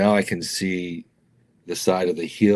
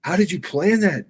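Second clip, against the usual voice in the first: high, excited director voice; amazed director; 11 semitones higher, 4 dB louder than usual. Retold or imitated. Director asking the crew how they pulled it off, high and amazed. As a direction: imitate the stunned director's disbelief. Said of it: "the director's like".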